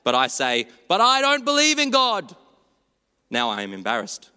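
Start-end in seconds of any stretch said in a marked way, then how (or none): 0.9-2.3 high, loud acted child's yell; hammed-up high shout; yelled outburst. A narrator's reading. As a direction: yell it out high, like a nine-year-old in church. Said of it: "not wanting to yell it out".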